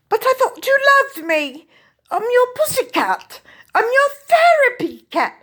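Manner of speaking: put-on high British accent, a plaintive pet pleading; British cat voice. British shorthair cat pleading with owner, high British accent. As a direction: speak as a wounded British pussycat. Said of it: "that's why it has a British accent".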